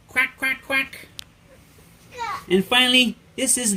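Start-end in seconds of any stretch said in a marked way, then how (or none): 0.1-1.1 high, soft little quacks; duck quacking. Pretend. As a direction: quack lightly like a small duck. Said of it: "And it goes quack".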